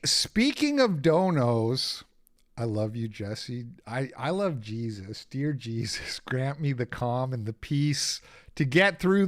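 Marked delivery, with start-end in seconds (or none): none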